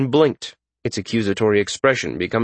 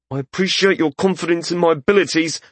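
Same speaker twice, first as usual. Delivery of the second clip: higher, tense voice through gritted teeth; 7 semitones higher, faster than usual. A narrator's reading. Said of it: "Wesson said through gritted teeth".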